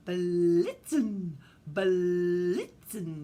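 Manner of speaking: "Blitzen" with a long, drawn-out tongued L; tongue-rolled Blitzen. Shown as a demonstration. Teacher shows children the tongue sound in "Blitzen", stretched L. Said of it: "have to do that with your tongue".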